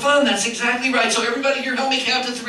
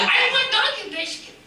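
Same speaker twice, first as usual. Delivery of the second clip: much higher pleading puppet voice; begging puppet dog; 14 semitones higher than usual. Acted. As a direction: whine for the biscuit. Puppet Chico begs.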